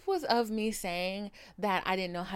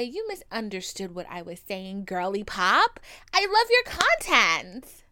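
mock sugary commenter; 8 dB louder, slower than usual. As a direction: ham up the fake niceness. Mocks the fake-nice commenter.

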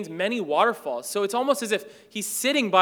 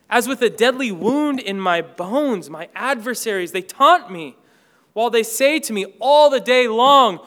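loud, driving scripture reading; preaching at full force; 2 semitones higher, 9 dB louder than usual. Acted. Preacher reads Psalm forty-two to the congregation, loud and driving. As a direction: proclaim the psalm to the whole room.

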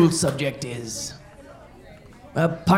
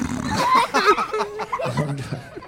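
high giggle after joke; high laugh; 14 semitones higher, 3 dB quieter than usual. Real, not acted.

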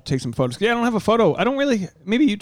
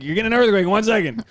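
higher, quick automated warning; mock alert voice; 7 semitones higher, faster than usual. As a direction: read it like a phone emergency alert. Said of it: "You get an alert, like".

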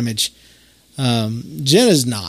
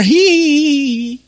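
high, loud put-on giggle; mock giggle; 13 semitones higher, 6 dB louder, slower than usual. Mom giggling as she fetches her daughter, high mock titter.

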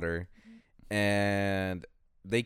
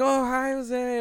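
much higher, delighted hello; excited greeting; 13 semitones higher, 4 dB louder, much slower than usual. Retold or imitated. Coffee shop staff greeting Jose, much higher and delighted. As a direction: greet him like a favorite regular. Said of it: "they're like".

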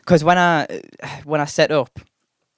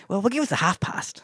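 high, soft, shaky-footed South African accent attempt; 8 semitones higher, 6 dB quieter than usual. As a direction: badly imitate a South African barmaid's accent. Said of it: "this is my South African accent".

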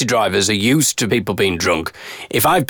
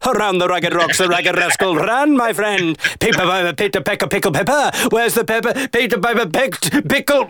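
higher-pitched, showy tongue-twister recital; 7 semitones higher than usual. Acted. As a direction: play someone proving they're not drunk. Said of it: "like, doing a tongue twister".

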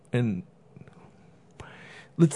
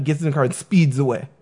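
ordinary talk

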